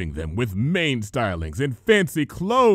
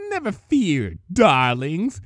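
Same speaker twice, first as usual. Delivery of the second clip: sweet villain; 4 semitones higher, 4 dB louder, slower than usual. Acted. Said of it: "Rarity said".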